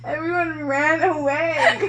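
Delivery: slow, high words broken by laughter; can barely get it out for laughing. Real, not acted. Laughing at friends who fled.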